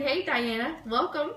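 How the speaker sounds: usual speaking voice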